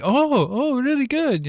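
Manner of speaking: high, gushing imitation of a fan; mock Thai admirer. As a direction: squeal it like an excited admirer. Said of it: "they're like".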